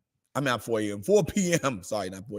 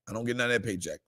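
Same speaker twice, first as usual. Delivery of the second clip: quiet aside; 5 semitones lower, 8 dB quieter, much faster than usual. Real, not acted. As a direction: lower and much softer, muttered to yourself. Same words repeated as an aside.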